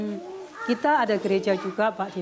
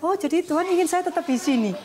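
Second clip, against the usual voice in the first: higher-pitched dawning understanding; retold realisation; 7 semitones higher than usual. Missionary finally understanding God's wish, retold realisation. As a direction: let it dawn on you, recounting it. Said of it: "Akhirnya saya ngerti gitu".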